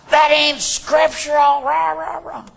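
slow, high mock bluster; mock angry tirade. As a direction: imitate someone tearing into a man. Mimics an argumentative attack.

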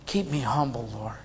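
low, soft, solemn preaching; real quiet, low earnestness; hushed humility. Real, not acted. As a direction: drop right down, sober and quiet.

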